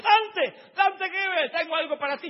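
very high, loud call across the crowd; calling out excitedly. Retold or imitated. Brother Tito calls Dante through the crowd, high excited call. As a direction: call his name like you've got a gift.

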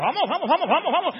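vamos gritado, agudo y muy fuerte; gritos de ánimo. Acted. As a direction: como una multitud que anima. Ángeles alientan a Isaac. (los ángeles alientan a Isaac a gritos)

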